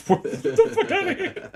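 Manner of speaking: laughing. Real, not acted. Laughing disbelief at the story.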